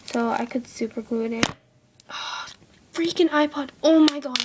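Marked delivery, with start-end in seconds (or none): 2.1-4.4 sharp, louder burst of irritation; annoyed outburst. Real, not acted. Vlogger loses patience with her iPod, louder exasperated outburst. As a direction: snap at the falling iPod. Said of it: "I'm getting so pissed".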